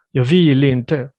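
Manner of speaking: Jag vill inte slow and loud, vill running into inte; linked words. Shown as a demonstration. Teacher modelling linked vill inte for a student, slow and loud. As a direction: run vill straight into inte, slowly. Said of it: "connect the L and the E".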